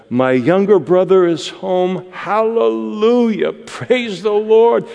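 mock joyful brother. Preacher pretending the older brother rejoiced, hammed-up delight.

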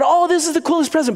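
put-on high, wowed child's voice; delighted kid. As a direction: act out a kid thrilled with a present. Kid delighted with his present.

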